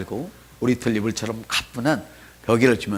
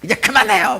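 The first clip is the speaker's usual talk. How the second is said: put-on annoyed child talking back; 9 semitones higher, 4 dB louder than usual. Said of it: "막 그러면서".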